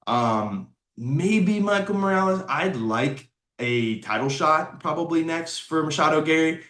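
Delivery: ordinary talk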